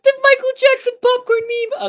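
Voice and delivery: squeaky high, loud meme imitation. Streamer riffing on a meme, high squeal.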